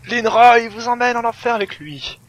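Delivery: high, loud hammed-up proclamation; mock war cry. Linra dragging enemies to hell, mock-heroic shout. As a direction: shout it like an action hero.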